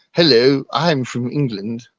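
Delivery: posh English greeting, drawn out at the end; posh accent. Pretend. Caller plays up being from England, posh accent. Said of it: "do I have to do a posh accent?".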